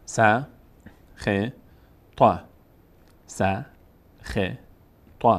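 "س، خ، ط" as isolated syllables with long gaps; one by one. Shown as a demonstration. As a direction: spell out "س، خ، ط" one at a time. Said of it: "حرفها رو یکی یکی بخوانید". (جدا جدا)

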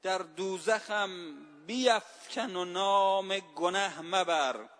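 slow falling recital of the verse; poem recital. Shown as a demonstration. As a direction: savor each word of the couplet. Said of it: "خیلی زیبا گفته صائب".

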